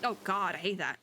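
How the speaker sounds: ordinary talk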